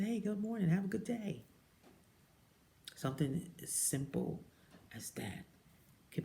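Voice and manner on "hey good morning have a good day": higher, sunny good morning; cheerful greeting. Retold or imitated. Imitating the lady greeting her, high and bright. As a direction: brighten up like a stranger cheering you. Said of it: "and she said".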